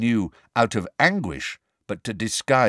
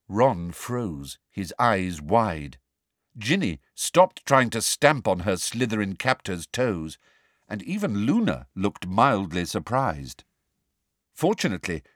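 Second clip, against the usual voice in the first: ordinary delivery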